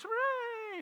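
squealed, very high mock hooray; cheering studio audience. Audience applauds the guest's cookery show, mock cheering. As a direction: parody a mindlessly delighted crowd. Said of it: "Everybody goes".

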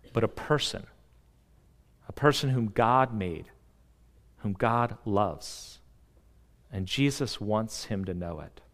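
soft, low, tender voice; quiet reassurance from the pulpit; tender hush. Real, not acted. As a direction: drop low and soft, lovingly. Tender hushed sermon passage.

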